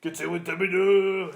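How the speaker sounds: loud, high mock-Japanese samurai shout; drawn-out caricature Japanese cry. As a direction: bellow like a stock Japanese samurai character. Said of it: "my racist Japanese voice sounds like".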